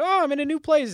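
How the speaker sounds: naive newcomer. Retold or imitated. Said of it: "I'm like".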